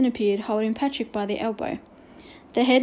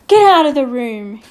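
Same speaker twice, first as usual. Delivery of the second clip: loud, commanding roar; 9 dB louder than usual. A narrator's reading. Headmaster drags the boy off, roaring the order. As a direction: bellow the order. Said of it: "he roared".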